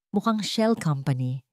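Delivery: plain reading voice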